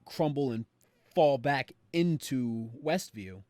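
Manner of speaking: ordinary talk, nothing marked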